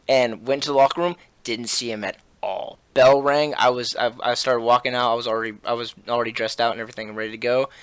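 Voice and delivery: ordinary delivery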